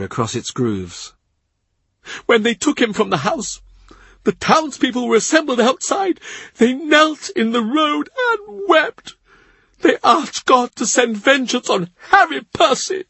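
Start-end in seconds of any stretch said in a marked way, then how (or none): 2.3-6.2 much higher grieving servant's voice; tearful Cavendish. A narrator's reading. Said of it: "He cries as he talks". 6.6-9.1 much higher, slow, voice breaking into tears; slow down and let the tears come through; weeping Cavendish. A narrator's reading. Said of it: "He cries as he talks". 9.8-13.0 much higher grieving servant's voice; tearful Cavendish. A narrator's reading. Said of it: "He cries as he talks".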